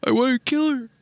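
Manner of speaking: high, whining sulky man voice; whiny mocking voice. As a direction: whine it like a spoiled brat.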